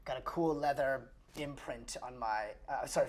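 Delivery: normal talking voice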